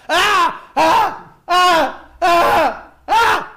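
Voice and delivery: loud, high repeated screams; string of hammed-up panic yells; re-enacted screaming. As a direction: keep screaming in steady bursts, as in a retold nightmare. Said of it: "Yo estoy gritando".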